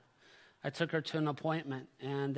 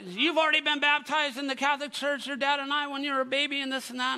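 high-pitched mother's protest, no louder; imitating indignant mom; 9 semitones higher, 4 dB louder than usual. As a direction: play a fired-up mom setting her child straight. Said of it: "She was fired up, but she wasn't excited".

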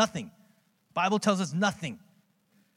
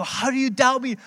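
higher and louder, teasing triumph; gloating; 8 semitones higher, 6 dB louder than usual. Retold or imitated. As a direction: rub the win in his son's face. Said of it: "rubbing it in his face".

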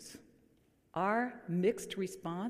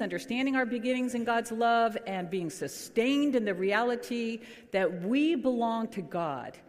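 ordinary delivery

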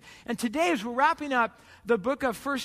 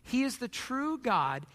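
scripture reading; 3 semitones lower than usual. Acted. Reads scripture aloud.

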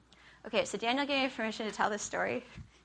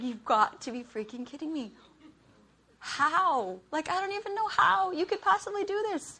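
incredulous outburst, pitch climbing high; exasperated disbelief; 7 semitones higher, 3 dB louder than usual. Retold or imitated. Wife fuming over the laundry basket, exasperated disbelief. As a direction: act out fed-up disbelief at a spouse. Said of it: "I wanted to kill him".

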